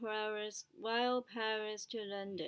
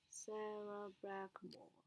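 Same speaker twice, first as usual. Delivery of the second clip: whispered; 10 dB quieter than usual. Real, not acted. Repeating a name to practise it.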